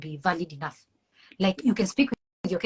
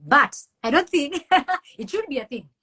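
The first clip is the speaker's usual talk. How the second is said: laughing; 7 semitones higher, 5 dB louder than usual. Real, not acted. Laughing at own question.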